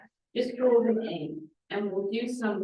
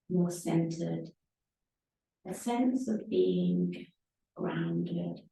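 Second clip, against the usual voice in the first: guided meditation; 4 semitones lower, 3 dB quieter than usual. Real, not acted. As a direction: settle them gently, phrase by unhurried phrase.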